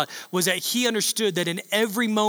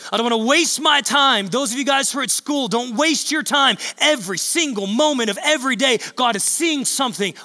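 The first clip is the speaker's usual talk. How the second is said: preaching; 4 semitones higher than usual. Acted. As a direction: push the point home to the church. Preaching builds.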